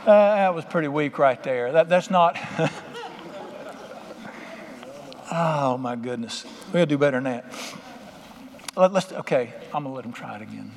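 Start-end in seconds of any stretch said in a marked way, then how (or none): 2.4-5.3 big laugh. Real, not acted. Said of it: "laughs at the weak response".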